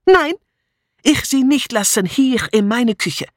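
put-on high-pitched, foreign-accented protest; defiant refusal. A narrator's reading. Mitzi guarding her kitchen from Julia, defiant. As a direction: refuse stubbornly, dragging the last words.

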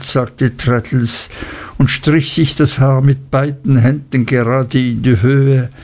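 ordinary talk

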